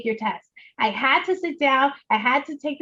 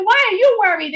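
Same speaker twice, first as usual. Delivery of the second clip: higher and louder with New York swag; 9 semitones higher, 7 dB louder than usual. Retold or imitated. Guest retelling her self-challenge with New York swag. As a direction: toss it off with Bronx New York attitude. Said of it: "In a New York, um, swag".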